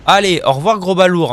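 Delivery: loud, high farewell; loud gleeful send-off. Real, not acted. Player seeing off a big lout, loud mocking goodbye. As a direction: call it out as a taunt. Taunting a falling game enemy.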